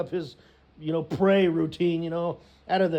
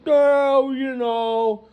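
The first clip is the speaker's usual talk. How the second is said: high, loud mock excuse to mom; evasive son; 8 semitones higher, 7 dB louder, slower than usual. Son answers mother about breakup.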